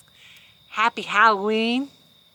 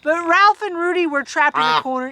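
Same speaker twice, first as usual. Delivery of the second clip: ordinary delivery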